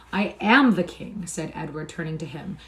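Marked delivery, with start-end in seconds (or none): 0.1-1.2 "am" stressed in slow, loud assertion; emphatic, regal insistence on "am"; insistent stress. A narrator's reading. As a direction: draw out "I am the king", leaning on "am". Prince insisting against mocking claim.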